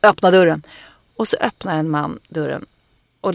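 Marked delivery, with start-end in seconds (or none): 0.0-0.6 loud, commanding police order through the door; darkest cop voice. Retold or imitated. A scared woman at the cabin door putting on her loud cop voice. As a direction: play the tough cop she has acted so often. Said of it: "med min mörkaste fan snutröst".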